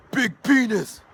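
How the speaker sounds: high, loud cheeky schoolboy; high loud teasing kid voice; imitating cheeky kid. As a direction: blurt it out like a prankster. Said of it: "he goes".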